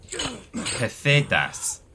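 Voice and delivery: lisping Andalusian accent. Shown as a demonstration. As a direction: say Pesetas as pethetath in a Spanish lisp, slow and high. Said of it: "You have to do the, the Spanish lisp".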